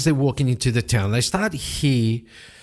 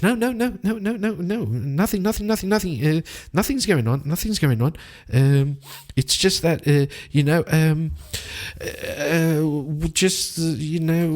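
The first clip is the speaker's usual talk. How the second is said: much higher, flustered rush of denials; panicked babbling; 5 semitones higher than usual. Acted. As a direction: backpedal nervously in a fluster. Jean panics, backpedals.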